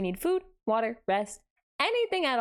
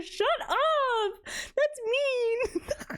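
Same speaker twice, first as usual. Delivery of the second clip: high mock-offended squeal; fake-hurt whine; playful outraged protest; 12 semitones higher, much slower than usual. Real, not acted. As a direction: squeal back at chat's teasing. Playful protest at chat's teasing.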